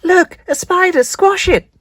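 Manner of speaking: high, loud panicked scream. Acted. Child spotting a spider, screaming in panic. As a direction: shriek it in alarm. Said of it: "screamed someone".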